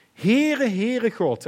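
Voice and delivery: loud, high ringing call of the Lord's name; God calling out. Acted. God calls out his name to Moses, loud. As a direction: proclaim it as God crying out his own name. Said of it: "dus God roept zelf".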